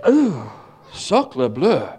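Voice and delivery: hammed-up French "Ooh, sacre bleu"; mock Frenchman, laughing through it; French accent. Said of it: "in a French accent".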